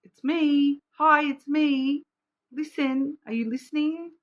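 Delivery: sing-song cosmic greeting, teasing; playful universe voice. Pretend. Universe greeting Capricorn, playful high call. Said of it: "The universe is like".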